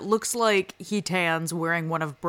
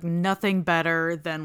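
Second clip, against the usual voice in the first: ordinary delivery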